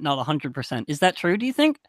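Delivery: ordinary talk, nothing marked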